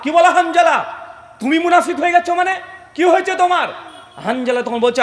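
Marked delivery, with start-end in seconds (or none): 0.0-3.7 high, shouted disbelief; astonished questioning. Acted. Abu Bakr startled by Hanzala's confession, astonished. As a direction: exclaim in shock, pitched well up. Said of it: "Abu Bakr অবাক".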